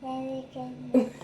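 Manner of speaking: words blurred by tongue out; tongue sticking out. Pretend. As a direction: say it with your tongue stuck out. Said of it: "You try it".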